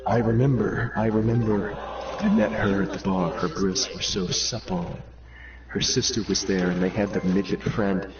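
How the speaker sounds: low, soft confessing sinner; low confessor voice. Acted. Sinner confessing to the priest, in a low hush.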